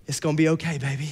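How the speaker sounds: tender reassurance. Retold or imitated. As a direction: soothe your wife gently. Husband comforting stressed wife.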